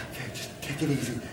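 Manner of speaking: low, soft soothing voice; calm reassurance. Acted. Fireman telling a dazed survivor to take it easy, calmly reassuring. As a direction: settle them down gently and quietly.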